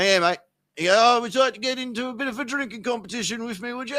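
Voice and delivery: very high, hammed-up British kangaroo voice; squeaky mock-British animal making an invitation; kangaroo's British accent. Kangaroo invites fishermen to a drinking contest, mock British accent. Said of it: "you've got a British accent".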